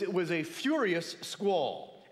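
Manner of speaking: "furious" leaned on, said slowly; slow it down and lean hard into "furious"; a slow quote that weighs the word "furious"; stress on furious. Shown as a demonstration. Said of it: "Emphasis on furious".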